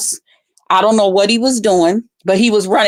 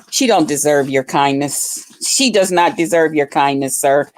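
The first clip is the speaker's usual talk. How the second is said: disgusted aside; 6 semitones lower than usual. Retold or imitated. Telling the host about Dragon, low disgusted scoff. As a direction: scoff under your breath. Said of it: "I was like, "Ugh".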